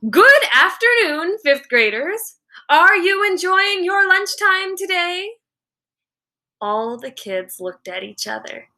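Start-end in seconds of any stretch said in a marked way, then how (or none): 0.1-5.4 much higher and louder, principal addressing the room; loud greeting. A narrator's reading. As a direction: call out brightly to the whole cafeteria. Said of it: "in a loud voice she said".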